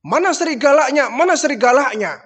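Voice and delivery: loud high demand; demanding villagers. Retold or imitated. Villagers rushing in, asking the boy, loud demand. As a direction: call it out, looking around.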